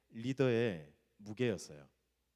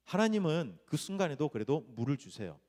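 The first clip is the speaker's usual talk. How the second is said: usual speaking voice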